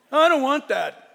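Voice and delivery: put-on a touch of a bratty kid; whiny child. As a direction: whine it like a picky kid refusing. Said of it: "child refusing dinner".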